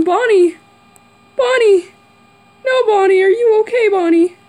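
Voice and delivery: high, loud alarmed calls of Bonnie; alarmed calling. Real, not acted. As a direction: call out in shock to Bonnie. Calling to glitched animatronic.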